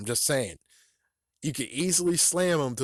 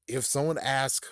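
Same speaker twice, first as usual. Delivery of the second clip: usual speaking voice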